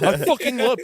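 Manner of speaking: high, loud gush of delight. Retold or imitated. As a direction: act thrilled by the suggestion. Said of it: "And I'm like".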